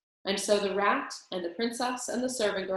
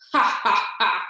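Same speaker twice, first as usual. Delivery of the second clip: mocking laugh; 7 dB louder than usual. A narrator's reading. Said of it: "Botticelli laughed".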